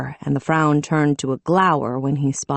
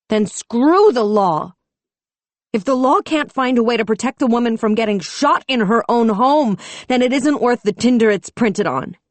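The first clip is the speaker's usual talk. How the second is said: defiant anger; 7 semitones higher, 4 dB louder than usual. A narrator's reading. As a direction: reject the law with passion. Defying the law.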